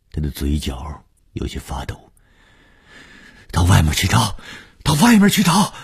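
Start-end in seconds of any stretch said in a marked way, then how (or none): 3.5-5.7 urgent shout rising higher and louder. A narrator's reading. Sending everyone outside to search, desperate shout. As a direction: shout the order, then push it higher. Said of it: "红玉大叫着".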